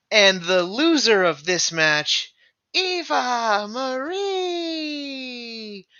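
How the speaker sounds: loud, high, drawn-out announcer call; ring announcer voice. Retold or imitated. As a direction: drag out the name like a ring announcer. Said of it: "grabbed the mic from the ring announcer".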